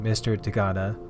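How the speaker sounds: ordinary delivery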